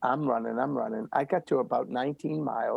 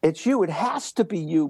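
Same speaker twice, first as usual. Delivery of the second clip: emphatic insistence; 3 semitones higher than usual. Real, not acted. Guest insisting to host that it's you, emphatic and raised. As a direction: drive home that it's you.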